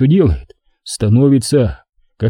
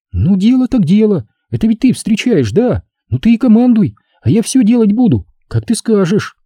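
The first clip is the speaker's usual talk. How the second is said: put-on high girlish voice, willingly giving in; Lyuba, ardent girl, eagerly compliant; 8 semitones higher than usual. A narrator's reading.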